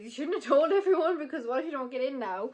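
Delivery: worried self-scolding. Retold or imitated. Student telling herself off for telling colleagues, worried. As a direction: scold yourself in a rising fluster. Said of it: "a little bit worried about that end".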